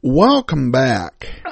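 usual speaking voice

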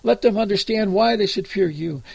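ordinary talk, nothing marked

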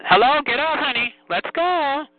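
high sing-song calling. Real, not acted. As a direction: call them back up, singing it. Calling someone back on line.

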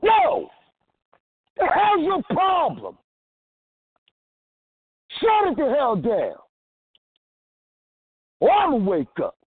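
high-pitched, drawn-out angry demand; forceful outburst. Shown as a demonstration. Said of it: "sometimes you're like".

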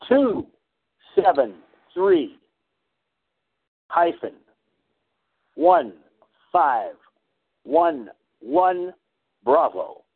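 slow, digit by digit with pauses; dictating. Real, not acted. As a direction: spell each character out, pausing. Caller gives part number slowly.

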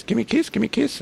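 parrot voice. Retold or imitated. Imitating the pet parrot Wally asking for kisses, high squawk. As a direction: mimic the talking parrot's squawk. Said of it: "you, he goes".

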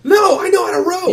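high, loud protesting cry; panicky kid's protest. Acted. Kid refusing to trade places at the oars, high yell. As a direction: yelp it back indignantly as the kid.

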